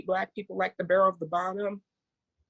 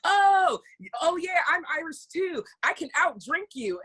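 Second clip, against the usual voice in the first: high, loud opening "oh", eagerly boastful; mock an overexcited tourist bragging; gushing wannabe-Irish tourist, pitched up; excited tourist impression; 8 semitones higher, 3 dB louder than usual. Mimicking boastful tourists claiming Irishness.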